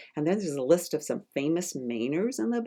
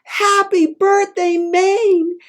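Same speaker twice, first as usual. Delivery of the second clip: much higher, louder booming greeting; Paul Bunyan yelling birthday wishes, much higher; Paul Bunyan shout; 9 dB louder, slower than usual. Acted. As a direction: burst out of the cake shouting. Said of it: "Let's say it for Moose".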